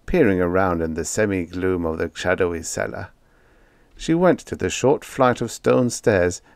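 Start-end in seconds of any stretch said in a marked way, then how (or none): none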